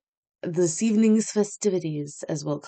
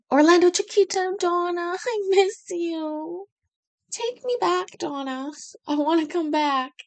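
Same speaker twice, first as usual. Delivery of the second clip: mock pining whine; 10 semitones higher than usual. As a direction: whine like a homesick kid begging to go back. Pining for a place she left.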